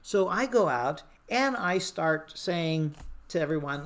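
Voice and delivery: ordinary talk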